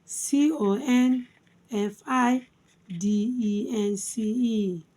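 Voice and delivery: measured spelling in three chunks, first ones lifted; spelled by syllables. Shown as a demonstration. Teacher spelling confidence for learners, syllable by syllable. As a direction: spell out letter by letter, pausing between syllable groups. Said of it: "Let's spell".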